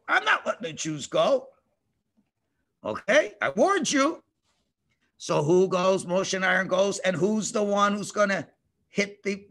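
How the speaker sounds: ordinary delivery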